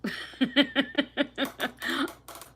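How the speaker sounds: high giggle bursting out; amused giggle at the comments. Real, not acted. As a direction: let a real laugh escape. Amused by commenters' accent claims.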